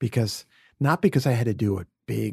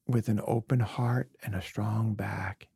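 ordinary talk, nothing marked

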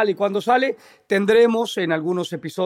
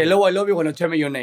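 mock English; 5 dB louder than usual. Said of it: "échese un".

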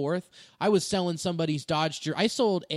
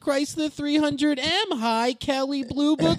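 mock salesman voice; 10 semitones higher, 9 dB louder, slower than usual.

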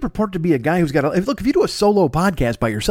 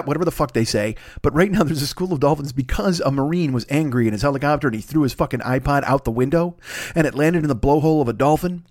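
ordinary delivery

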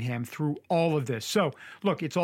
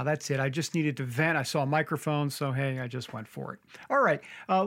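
normal talking voice

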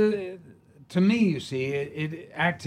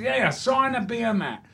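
put-on cockney drunk; 10 semitones higher than usual. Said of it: "He goes".

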